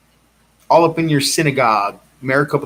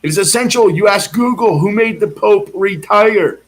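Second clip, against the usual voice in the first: higher, louder proclamation; a raised, insistent public announcement; accosting shout; 7 semitones higher, 4 dB louder than usual. Retold or imitated. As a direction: declare it at each agent. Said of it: "started accosting each one saying".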